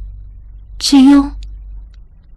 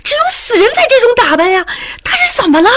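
shocked gossiping woman; faster than usual. Acted. Shocked onlooker, high and fast.

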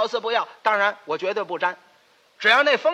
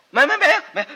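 hasty denial; 6 semitones higher, 7 dB louder than usual. Acted.

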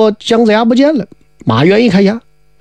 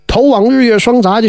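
plain reading voice

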